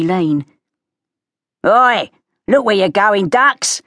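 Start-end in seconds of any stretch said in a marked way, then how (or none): 1.6-3.8 costermonger shout. Acted. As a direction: bellow it like a market trader.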